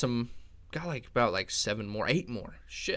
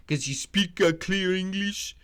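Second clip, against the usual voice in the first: higher, slow put-on accent; mock broken English; 8 semitones higher, slower than usual. Joking about Pantoja's English, slow mock accent.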